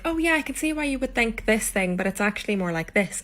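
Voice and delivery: high-pitched, friendly correcting. Retold or imitated. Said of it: "thinking I'm being friendly".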